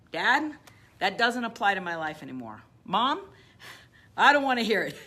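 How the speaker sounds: loud, defiant call-out to Dad and Mom; loud defiance of the inner critic. Shown as a demonstration. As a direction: talk back to the parents' voices, loud. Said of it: "So call it out for the name".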